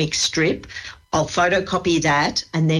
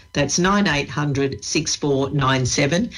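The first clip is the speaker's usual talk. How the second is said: ordinary delivery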